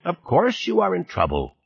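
put-on high-pitched scornful old man; mildly snorting impatience; dismissive snort. A narrator's reading. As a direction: scoff as if it were obvious. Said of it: "Dorfman snorted".

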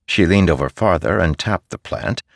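plain reading voice

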